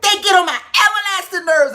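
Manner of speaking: high, loud exasperated yell; mock yelling. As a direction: rant about coworkers to her son. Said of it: "I'm yelling, I'm screaming".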